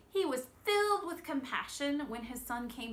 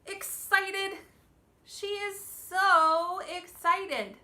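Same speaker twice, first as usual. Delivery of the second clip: high, loud and slow, bursting with excitement; slow, high, thrilled teacher voice; excited; 9 semitones higher, 11 dB louder, much slower than usual. Shown as a demonstration. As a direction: gush it out like you can hardly sit still. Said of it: "this one is".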